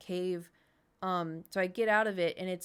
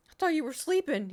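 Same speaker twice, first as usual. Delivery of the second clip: high, loud, caught off guard; surprised reply; 8 semitones higher, 5 dB louder than usual. Retold or imitated. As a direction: act out surprise at a dad who is awake. Surprised reply to dad, retold.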